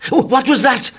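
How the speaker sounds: high, loud startled cry; hearing a loud bang, high startled cry. A narrator's reading. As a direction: gasp it out at the bang. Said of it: "I cried".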